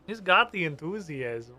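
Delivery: usual speaking voice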